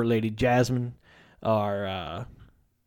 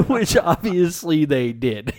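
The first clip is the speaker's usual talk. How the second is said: higher, slow words through laughter; laughing; 8 semitones higher, 3 dB louder, slower than usual. Real, not acted. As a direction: crack up while commenting. Cracks up at the quoted line.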